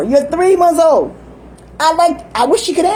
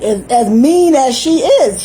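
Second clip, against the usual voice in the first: ordinary talk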